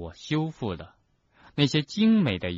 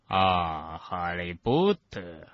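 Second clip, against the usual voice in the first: slow, low drawl of disdain; cold, aloof; 4 semitones lower, much slower than usual. A narrator's reading. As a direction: draw the name out coldly and low. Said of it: "用一种冷漠的腔调说".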